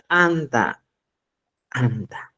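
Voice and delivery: ANTA begun with a popped ejective t; explosive t. Shown as a demonstration. Teacher saying Cusco's word for bread, explosive ANTA. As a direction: burst the tongue off the teeth into ANTA. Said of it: "Es explosivo".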